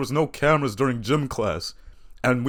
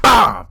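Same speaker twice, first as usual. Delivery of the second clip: loud slap sound effect; 5 semitones higher, 8 dB louder than usual. Retold or imitated. Imitating the ass slap.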